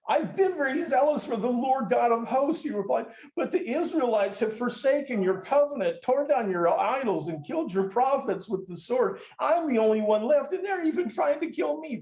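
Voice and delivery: high, whining complaint; whiny. Shown as a demonstration. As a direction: read it like a sulky child begging for pity. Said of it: "read this in a different tone of voice".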